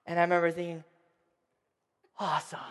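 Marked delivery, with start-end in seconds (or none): 2.2-2.6 put-on low, soft awestruck child; quietly amazed; hushed awe. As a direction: breathe it out in wonder. Awestruck child thinking in bed.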